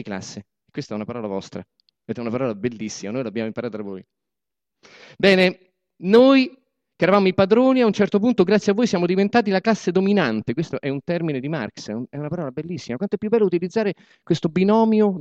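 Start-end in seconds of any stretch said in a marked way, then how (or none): 5.2-10.5 high, loud speech to the crowd; booming speech from the podium; rally oration. Acted. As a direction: proclaim it like a political orator. Boss addresses comrades as rally.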